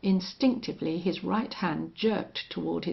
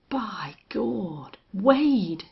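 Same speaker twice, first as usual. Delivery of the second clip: startled exclamation; 3 semitones higher, 5 dB louder, much slower than usual. Acted. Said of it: "he ejaculated".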